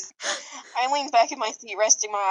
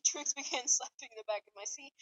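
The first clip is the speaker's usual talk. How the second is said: ordinary talk, nothing marked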